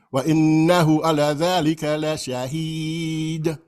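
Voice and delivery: slow recitation with the final d of shahid bounced; shaken final D. Shown as a demonstration. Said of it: "I'm shaking that D".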